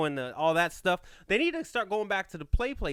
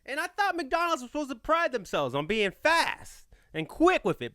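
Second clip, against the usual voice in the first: normal talking voice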